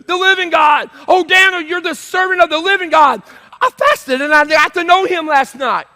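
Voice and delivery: high, impassioned shout; yelling king. Acted. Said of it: "he starts yelling".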